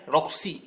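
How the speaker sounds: Roksi spelled out slowly, with a strong R at the start; over enunciating. Shown as a demonstration. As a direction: stress Roksi clearly with a rising end. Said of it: "coba dengar baik lagi ya".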